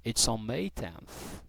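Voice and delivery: slow, soft, careful answer; slow model answer. Shown as a demonstration. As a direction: say it slowly for learners. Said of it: "Como que você vai falar?".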